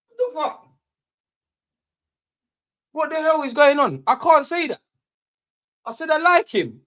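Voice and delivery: high, loud, disbelieving rant at chat; exasperated rant. Real, not acted. Streamer rants at a chat viewer, exasperated and loud. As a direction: let it burst out, baffled and fed up. Ranting at a viewer over his opinion.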